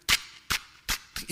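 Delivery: slow, breathy clicking of beaks; voiceless pecking noise; beak tapping imitation. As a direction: tap it out like two beaks knocking.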